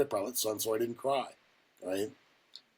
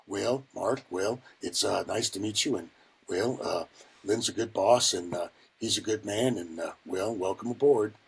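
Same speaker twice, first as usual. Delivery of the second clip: low, halting, folksy welcome; halting elder-statesman imitation; 5 semitones lower than usual. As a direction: imitate an unhurried, hesitant man in charge. Imitates busy man greeting him.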